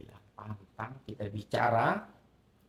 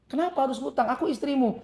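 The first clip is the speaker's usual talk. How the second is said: wife protesting; 12 semitones higher, 6 dB louder than usual. Retold or imitated. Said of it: "wife's reply".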